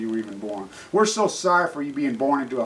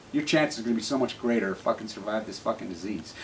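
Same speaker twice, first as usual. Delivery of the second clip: usual speaking voice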